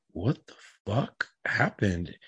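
slow, drawn-out demand for an explanation; retold incredulous questioning. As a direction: drag the question out, word by word. Retold questioning of his daughter.

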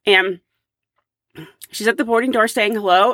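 ordinary talk

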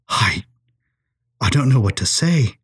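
halting start with a long pause; stammering. A narrator's reading. Professor stunned by her confession, stammering. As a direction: falter after the first word, lost for words. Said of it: "O'Malley stammered".

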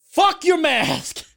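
drawn-out, high, loud outburst; mock defiant yell. Customer throwing the mask back at staff, mock defiant yell. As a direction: yell it slowly and defiantly, imitating a troublemaker.

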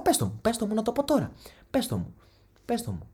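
mock-heated challenge to his mother. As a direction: dare her to say it.

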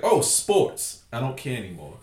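normal talking voice

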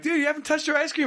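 lightly voiced cartoon boy; high, lightly voiced cartoon kid asking; mock kid voice.

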